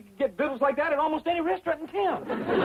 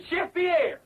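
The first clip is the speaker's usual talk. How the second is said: put-on higher, more urgent call in French accent; insistent cue; 7 semitones higher than usual. Host cueing an absent chef, insistent call. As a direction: call the missing chef on stage.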